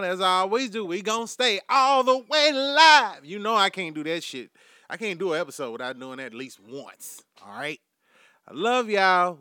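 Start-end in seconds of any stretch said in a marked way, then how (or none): 0.8-3.2 slow, loud and higher, drawn out; a rallying shout, slow and high; loud promise. Real, not acted. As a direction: belt out the promise to the fans. Promising fans the show stays live.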